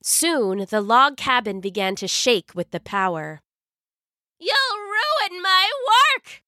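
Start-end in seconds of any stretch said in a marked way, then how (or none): 4.4-6.4 high, desperate witch cry; cried out. Acted. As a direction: cry it out in panic. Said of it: "the witch cried".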